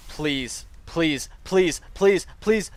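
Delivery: frantic pleas, rising step by step; desperate pleading. Real, not acted. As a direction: repeat it urgently, climbing into loud protest. Begging the game for a chance.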